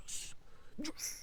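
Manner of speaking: hushed mock celebration scream; muted hype. Teen celebrating alone in the van, muted hype. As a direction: squeeze out a whispered cheer. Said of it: "I was so fucking hyped".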